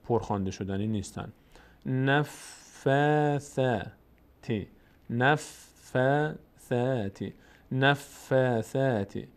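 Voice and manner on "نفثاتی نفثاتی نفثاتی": نفثاتی with thin ف and ث; slow thin recitation; light unemphatic vowels. Shown as a demonstration. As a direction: keep the alif light in نفثاتی. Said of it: "پر نمیشن".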